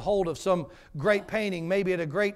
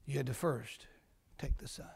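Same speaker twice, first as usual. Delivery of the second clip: hushed whisper; 5 semitones lower, 11 dB quieter than usual. Acted. Preacher repeats the punchline to the congregation, whispering. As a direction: drop the voice to a whisper on take the son. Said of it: "repeated softer as a whisper".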